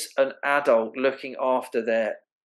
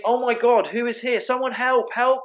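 high, frantic mock panic calling for help; imitating a frightened onlooker crying out; panicked; 10 semitones higher than usual. Onlooker panicking at a birth, frantic high cries for help.

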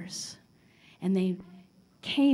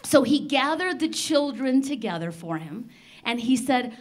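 plain reading voice

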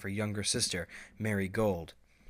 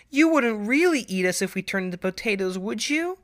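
much higher little-filly voice rising into the question; serious filly question; 12 semitones higher, 8 dB louder than usual. Acted. Marigold asking her mother in earnest, high worried question. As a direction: ask it in total earnest like a small child. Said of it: "she asked, completely serious".

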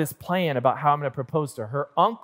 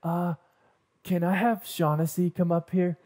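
timid into the mic; 4 dB quieter than usual. Retold or imitated. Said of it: "I said".

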